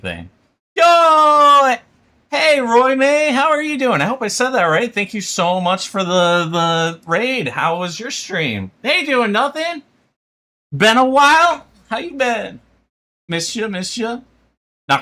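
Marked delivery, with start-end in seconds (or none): 0.8-1.7 loud, high shouted greeting; big high yell of welcome; excited call. Real, not acted. Greeting a raider on stream. 2.3-14.2 high, bright, louder streamer greeting; excited welcome. Real, not acted.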